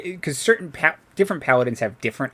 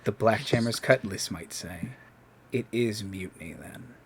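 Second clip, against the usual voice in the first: quiet sword voice; 3 semitones lower, 13 dB quieter than usual. Acted. Raven Queen voice, hushed.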